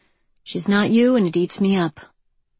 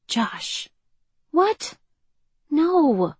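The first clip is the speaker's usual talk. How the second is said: high, stunned gasping words; shocked disbelief; 8 semitones higher, much slower than usual. A narrator's reading. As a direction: react in total disbelief.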